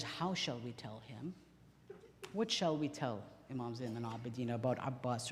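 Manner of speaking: very soft, low, subdued grief; hushed, as if keeping tears in; holding back tears. Retold or imitated. Sister asking her brother how to tell him, holding back tears. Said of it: "she held back tears".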